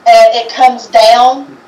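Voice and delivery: ordinary talk, nothing marked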